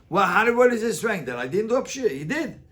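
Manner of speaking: ordinary delivery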